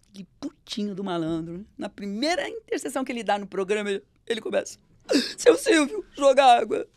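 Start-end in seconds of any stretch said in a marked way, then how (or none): 5.4-6.9 higher, louder hammed-up sobbing plea; fake-cry to milk the moment; mock crying. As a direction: colleague teasing on air with mock tears. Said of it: "começa a chorar".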